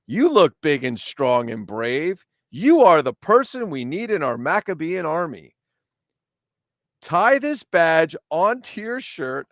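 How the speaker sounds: high, slow, hammed-up recruiting commander; declamatory army leader with stretched words; Judah the Maccabee. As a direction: proclaim it grandly to a young recruit.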